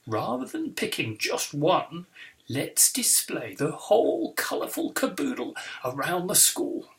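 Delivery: jovial posh TV-presenter voice; plummy, theatrical teacher's welcome; posh supply teacher. Acted. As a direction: greet the class like someone posh off the telly. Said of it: "He sounds like somebody posh off the telly".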